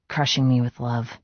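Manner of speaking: ordinary delivery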